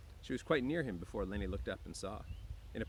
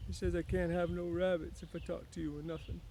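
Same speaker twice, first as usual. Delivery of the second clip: Lenny voice; 8 semitones higher than usual. Acted. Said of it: "Lenny looked down at the hay".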